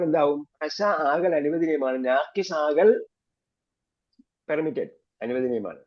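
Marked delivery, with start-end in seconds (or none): none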